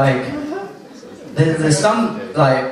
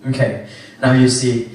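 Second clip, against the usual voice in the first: usual speaking voice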